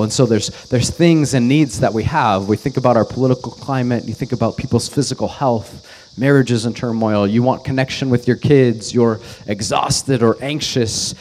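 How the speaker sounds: normal talking voice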